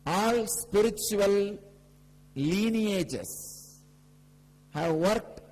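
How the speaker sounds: high, drawn-out proclamation, phrase by phrase; slow, forceful spiritual proclamation; emphatic preaching. Real, not acted. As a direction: stretch each key phrase and declare it to the whole gathering. Preaches the main teaching.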